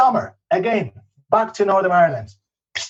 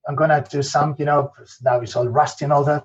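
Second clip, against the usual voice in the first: normal talking voice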